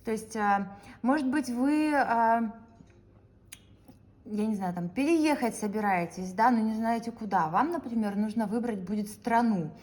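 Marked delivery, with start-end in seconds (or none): none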